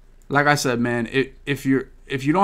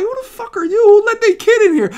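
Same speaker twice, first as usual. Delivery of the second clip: mock incredulous; 4 dB louder than usual. Retelling own reaction, high voice.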